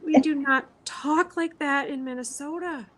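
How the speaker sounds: indignant retort. Retold or imitated. Indignant retort about Minnesota accent.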